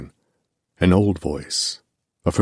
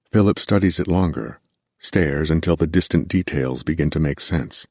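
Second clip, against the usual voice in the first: usual speaking voice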